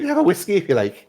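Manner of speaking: high, chiding barmaid voice; tavern owner voice. Acted. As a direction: scold him like a prim landlady. Lara voice.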